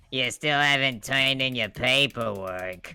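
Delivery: slow, loud nagging clerk voice; Roz. Acted. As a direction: draw it out like a cartoon paperwork enforcer. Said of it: "It kinda sounds like Roz from Monsters".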